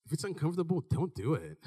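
low, soft pampering voice; coddling mother. Retold or imitated. Mother excusing her son from hard things, soft. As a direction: let him off easy, gently. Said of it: "I was like".

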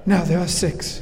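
hushed nature-documentary narrator; doing husband's Attenborough bit; David Attenborough impression. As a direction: mimic the wildlife documentary host, grave and hushed. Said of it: "Nigel has a great A-, David Attenborough, uh, impression".

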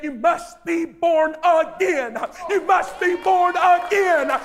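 climbing to a full preaching roar; building preached shout. Acted. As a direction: hammer it home harder and harder. Preacher repeating Jesus' words.

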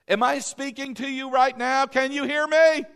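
loud, high-pitched projection to the room; calling out. Shown as a demonstration. Preacher checking the congregation hears him, calling out. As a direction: call out as if checking everyone hears. Calls out to test hearing.

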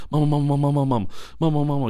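put-on high, loud child's nagging; whiny little kid. Little boy pulling on his mama's shirt, high nagging. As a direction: tug at mom with a pleading child voice. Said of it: "He's pulling on her shirt".

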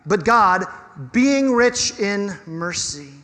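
verse repeated slowly, with a pause after God; slow, emphatic. Real, not acted. Preacher repeating Paul's introduction of God, slow and emphatic. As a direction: land each phrase with weight.

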